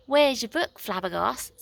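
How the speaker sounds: Nine's own voice, corrected; right voice for Nine. A narrator's reading. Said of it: "No, sorry, that's the wrong one".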